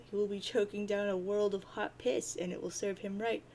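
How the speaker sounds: usual speaking voice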